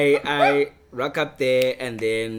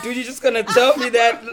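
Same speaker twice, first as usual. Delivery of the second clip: high, loud, laughing disbelief; a stunned laugh at the news; cracking up in disbelief; shocked laughing disbelief; 10 semitones higher, 8 dB louder than usual. Retold or imitated.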